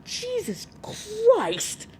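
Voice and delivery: drawn-out, high-pitched exclamation; shocked outburst. Real, not acted. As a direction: exclaim in shock, stretching each word high. Shocked reaction to the story.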